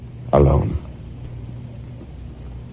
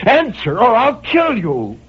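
cried out; slower than usual. A narrator's reading. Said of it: "And I cried out".

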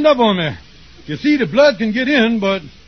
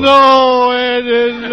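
fake sobbing no; 2 semitones higher, 5 dB louder, much slower than usual. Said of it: "Would you stop crying".

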